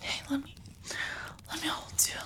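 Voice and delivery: hushed, breathy confession to a lover; soft ASMR whisper; intimate whisper. Acted. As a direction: whisper it close to the ear, afraid to lose them. Cuddling whisper to partner.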